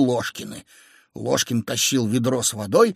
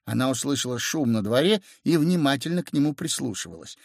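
usual speaking voice

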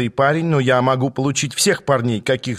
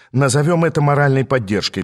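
ordinary delivery, nothing marked

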